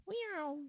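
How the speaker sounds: high playful meow; cat meow. Pretend. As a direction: meow like a kitten. Meows at a cat.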